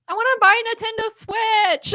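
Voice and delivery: put-on high child's plea; little niece voice. Niece asking her aunt for money, high child voice. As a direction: ask like a small girl who wants something.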